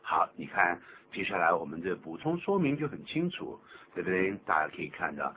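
ordinary talk, nothing marked

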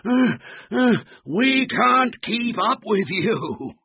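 high, exhausted gasping wail; panting. Acted. Husky dogs unable to match Floppy, panting. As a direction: pant between words, pitched high and loud. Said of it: "panted the husky dogs".

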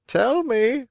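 high-pitched storybook bird voice; pigeon calling down. A narrator's reading. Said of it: "asked the pigeon".

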